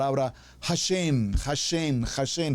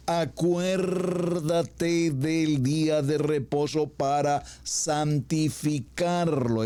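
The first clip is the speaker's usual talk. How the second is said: slow, rising to a firm close on "en vano"; solemn commandment; 3 semitones higher, much slower than usual. Acted. Preacher proclaiming the third commandment, solemn.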